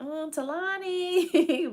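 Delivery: high, slow, drawn-out deflection; passing the buck. Retold or imitated. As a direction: drawl the sister's name to dodge it. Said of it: "She's like".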